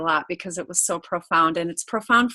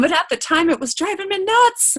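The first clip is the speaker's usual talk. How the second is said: amused exasperation; 10 semitones higher, 4 dB louder than usual. Real, not acted. Mom recalling exasperation, laughing.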